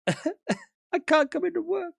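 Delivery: high, slow, put-on poorly voice; mock sick caller. Calling the boss to skip work, fake sick. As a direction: whine it feebly, faking sick for work.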